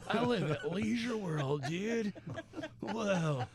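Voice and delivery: slow, stretched-out surfer voice; mock surfer dude. Host imitating a surfing colleague, slow mock dude voice. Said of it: "It's just like".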